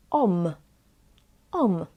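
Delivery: "om" as O closed by a clear m; om sound. Shown as a demonstration. Teacher shows ą before B or P, om sound. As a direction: round off "om" on an m. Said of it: "we pronounce it as".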